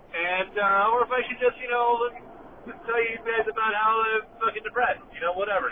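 ordinary talk

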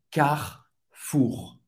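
Carrefour slow, with the R at the end heard; final R sounded. Shown as a demonstration. Teacher models the final R, very slowly. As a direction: let the last R of Carrefour ring out. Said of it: "pronunciar também o R no final".

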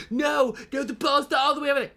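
high, fast, indignant mock fan voice; mocking fan accounts. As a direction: squeal it like a deluded fan in denial. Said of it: "these United fan accounts that are like".

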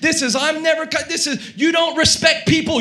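loud, high angry tirade; high-pitched shouted complaint; abusive ranting. Retold or imitated. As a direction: rant at customer service, hammed-up. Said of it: "I am abusing the person".

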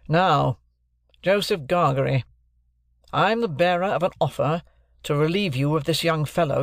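stern lawyer. Acted. Jaggers introducing himself to Joe and Pip, stern lawyer. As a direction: announce yourself like a cool London lawyer.

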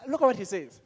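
usual speaking voice

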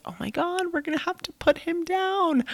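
high, panicked blurt of her first thought. Retold or imitated. Mother reliving panic over her biting dog, high and frantic. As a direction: squeal it out like your worst fear just hit. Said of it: "And I freaked out".

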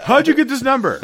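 high squeaky mock dad, hammed up; high, loud cartoon dad voice. Voicing the dad on phone.

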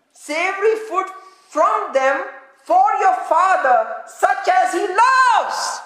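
high, phrase-by-phrase scripture delivery; emphatic preaching. Real, not acted. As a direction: build to a drawn-out, ringing last phrase. Preaching verse at full force.

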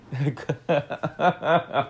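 long run of chuckles; amused laugh. Real, not acted. Podcaster amused by a chef saving marriages, drawn-out laughter. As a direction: crack up at your own story.

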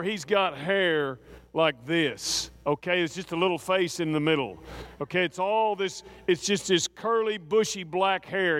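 usual speaking voice

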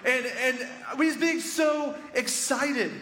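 high-pitched, slow emphatic excitement; gushing, drawn-out storytelling; excited. Retold or imitated. Acting out the guard's excitement.

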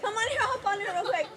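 high, laughing call to come over; excited calling over. Real, not acted. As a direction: wave her over, giggling.

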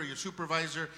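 usual speaking voice

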